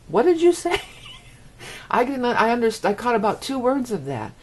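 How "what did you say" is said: high, loud mock-bewildered question; baffled outburst. Listener lost by fast talkers, mock bewilderment. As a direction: squawk it in exaggerated disbelief.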